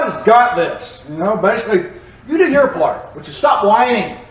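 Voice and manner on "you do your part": ordinary talk, nothing marked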